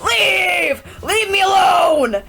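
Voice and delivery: high, loud, slow scream. Acted. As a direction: scream it slowly. Screaming at hallucination.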